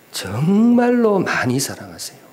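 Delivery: 정말로 stretched out, high and swelling; emphatic 정말로. Real, not acted. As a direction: lean hard and long on 정말로. Stressing how much God loves.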